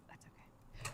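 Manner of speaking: soft whispered aside. Real, not acted. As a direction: breathe it out in a whisper. Repeats reassurance, second whispered.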